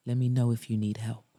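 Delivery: brisk, low-pitched man's offer; low male character. A narrator's reading. Mason stepping back from Liza, low male voice. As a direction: drop the pitch and offer it quickly.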